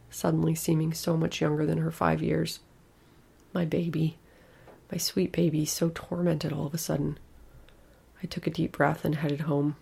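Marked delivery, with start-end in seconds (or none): none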